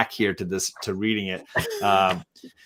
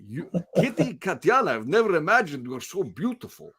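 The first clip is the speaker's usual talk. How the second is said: put-on higher, swooping Russian accent; Colossus, Russian accent; 6 semitones higher than usual. Colossus declaring love to Kitty, theatrical Russian accent. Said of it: "Colossus's lines with a Russian accent".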